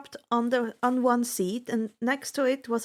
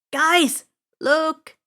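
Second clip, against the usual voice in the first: loud, higher call to friends; 5 semitones higher, 8 dB louder than usual. A narrator's reading. Grover calling his friends to look down. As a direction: call them over, excited.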